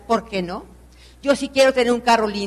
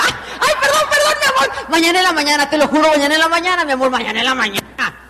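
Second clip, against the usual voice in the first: squeaky high, giggling sorry that sinks back down; hammed-up wheedling promise to her husband; mock pleading apology; 5 semitones higher than usual. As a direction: beg forgiveness like a sleepy wife caught out. Apologising to husband after oversleeping.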